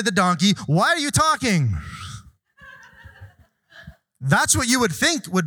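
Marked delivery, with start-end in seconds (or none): 0.7-1.8 pitched up high in mock disbelief; incredulous question. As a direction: act out the shock of a talking donkey. Balaam's expected shocked reply.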